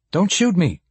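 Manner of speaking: high-pitched, urgent plea; sudden high cry of alarm. Acted. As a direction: plead for your life at gunpoint. Pleading with an armed cop.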